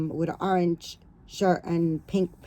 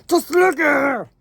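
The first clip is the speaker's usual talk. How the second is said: excited gush; 7 semitones higher, 9 dB louder than usual. Real, not acted. Gushing excitedly over doll.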